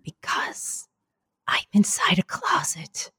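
unvoiced hushed whisper into the phone. Real, not acted. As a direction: whisper it so nobody hears. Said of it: "why are you whispering".

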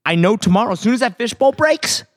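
usual speaking voice

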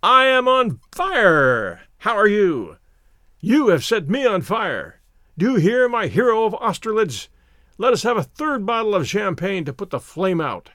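loud high outburst, easing slightly; mad exhilaration. A narrator's reading. Drunk narrator demanding a third bottle, madly exhilarated. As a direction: cry out wildly, drawing out the first words. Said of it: "in a mad state of exhilaration".

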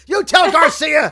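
loud, high, slow bellow; boss shouting. Acted. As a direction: roar the order at the assistant. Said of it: "C E O trailer guy".

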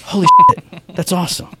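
imitating his own excited shout; stunned delight. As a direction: blurt it out, thrilled and laughing. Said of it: "And I went".

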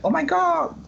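high, gushing mock London accent. Mocking a London lady in Singapore, high gushing London accent. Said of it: "I can't do a London accent".

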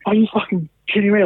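high-pitched mock outrage; incredulous quote, pitch jumping up; retold anger. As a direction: imitate her indignant disbelief. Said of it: "getting really angry at me".

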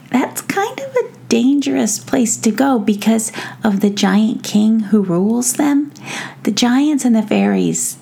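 high-pitched gnome voice; little gnome hesitantly explaining a danger; uneasy gnome. Acted. Tom Gnome warns of the giant king's mountains, uneasy.

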